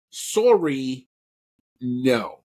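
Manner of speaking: a light put-on Canadian accent; polite mock Canadian "Sorry," then a flat "no.". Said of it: "I'm gonna put my best Canadian accent".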